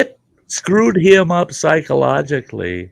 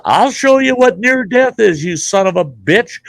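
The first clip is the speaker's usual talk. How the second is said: high, loud yelled threat; imitating a furious patient; angry threat; 10 semitones higher, 5 dB louder than usual. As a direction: yell it at the doctor. Retold threat to the doctor.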